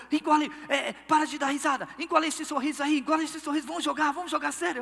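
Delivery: a football buddy's irritated reprimand; scolding teammate. Retold or imitated. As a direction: tell him off to stop laughing and play. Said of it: "e o cara falou".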